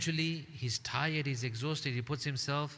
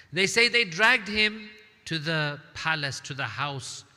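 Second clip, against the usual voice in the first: impassioned; 3 semitones higher, 6 dB louder than usual. Acted. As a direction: cry it out to the congregation. Dramatic climax.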